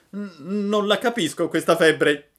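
high, loud startled puppet; Pinocchio alarmed. A narrator's reading. Pinocchio startled by the news, high and loud. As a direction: jump up in alarm.

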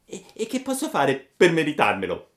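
put-on high, eager puppet boy; Pinocchio voice. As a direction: play the puppet boy, pleading. Pinocchio's voice in dialogue. (Pinocchio ragazzino, voce alta e ansiosa)

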